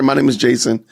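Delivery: ordinary talk, nothing marked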